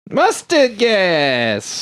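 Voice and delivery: very slow, stretched words; drawn-out answer. Acted. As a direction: drag every syllable out. Repeated joke answer drawn out.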